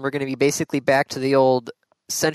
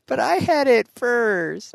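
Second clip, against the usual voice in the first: put-on whiny child; 8 semitones higher, slower than usual. Childish playful quarrel.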